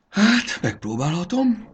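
high-pitched, reluctant sigh; hesitant sigh. A narrator's reading. Fanny agrees to try luring the caterpillar, sighing hesitantly. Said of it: "sóhajtott Fanny".

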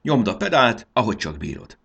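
high, loud start, easing at the end; urgent shouted order; shouted urging. A narrator's reading. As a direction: urge the driver on with a yell. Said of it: "kiáltotta Fanni".

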